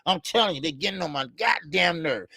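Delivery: ordinary talk, nothing marked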